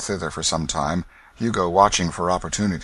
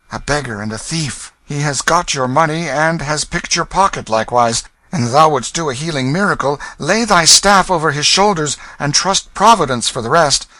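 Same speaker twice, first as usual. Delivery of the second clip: higher, dry sarcastic exposure; cool young king; 9 semitones higher, 7 dB louder than usual. Acted. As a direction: unmask the thief.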